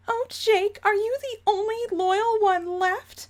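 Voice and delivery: high, simpering Umbridge-like matriarch; high-pitched theatrical appeal; Jane voice. Acted. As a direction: plead in a high, affected ladylike voice. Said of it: "my Jane voice is slowly turning into Dolores Umbridge".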